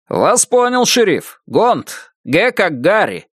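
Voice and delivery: brisk dispatcher repeating back; голос диспетчера. Acted. Диспетчер отвечает по рации. (голос диспетчера по рации)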